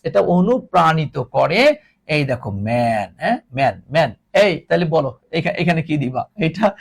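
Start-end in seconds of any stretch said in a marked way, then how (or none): none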